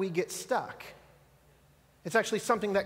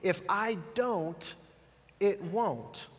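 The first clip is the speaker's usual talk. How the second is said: slow, softer, deliberate; slow inner thought; 3 dB quieter, much slower than usual. Shown as a demonstration. Pastor voices everyone's inner thought, slow and soft. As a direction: drag it out as a quiet conviction. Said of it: "this thought thinking".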